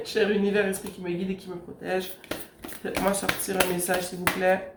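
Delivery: soft rushed prayer to the spirits; hushed invocation. Acted. Tarot reader quickly pleading with her spirits, hushed. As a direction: murmur the plea to the universe. Invocation again while shuffling.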